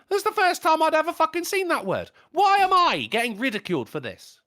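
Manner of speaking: indignant. Retold or imitated. Acted protest at being laughed at.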